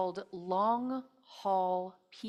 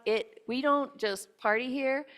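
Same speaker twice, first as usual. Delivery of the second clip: normal talking voice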